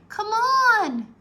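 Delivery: high, loud pleading yell; urgent shout. A narrator's reading. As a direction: yell at him to leave. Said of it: "Annie was shouting".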